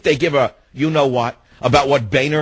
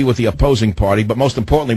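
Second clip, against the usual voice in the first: ordinary talk, nothing marked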